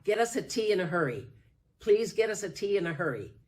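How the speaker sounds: fast, loud call for tea; urgent yell. Retold or imitated. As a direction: hurry them along. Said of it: "she started to yell".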